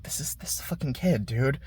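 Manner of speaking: hushed, fast protest. Retold or imitated. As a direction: mutter it fast under your breath. Himmel still refuses.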